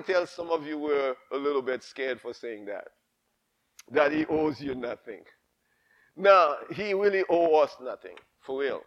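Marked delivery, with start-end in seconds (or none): none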